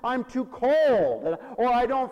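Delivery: loud, high, drawn-out excuses; mock lament. Pastor mimics people waiting to feel softened, mock wailing. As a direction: wail each excuse, stretching the last word.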